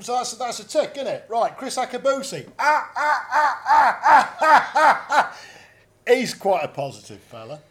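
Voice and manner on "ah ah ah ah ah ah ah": high, loud ah-ah-ah laugh; imitated booming laugh. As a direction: bellow a mock hearty laugh.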